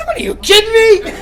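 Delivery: high-pitched mock disbelief; incredulous. Comic answering the vet's odd request, incredulous.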